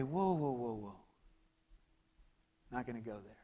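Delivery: hushed calming whoa. Acted. Preacher voicing calm words that defuse an argument. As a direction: gently settle a heated friend.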